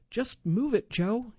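a touch of calling out, as read aloud. A narrator's reading. Trapped husband tells his wife to move boulder, mild call. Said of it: "he called out".

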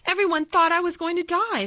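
put-on high, loud boy's retort; curt. A narrator's reading. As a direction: say it shortly, pitched high and forceful. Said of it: "said Colin shortly".

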